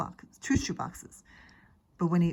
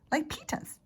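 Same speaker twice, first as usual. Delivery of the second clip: a lightly voiced small child, higher; little boy voice; 10 semitones higher than usual. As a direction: say it like a toddler copying his big brother. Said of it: "he said".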